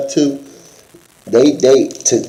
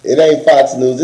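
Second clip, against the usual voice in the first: normal talking voice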